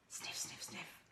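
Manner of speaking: breathy dog sniffs; whispered sniffing. Acted. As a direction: sniff softly under your breath.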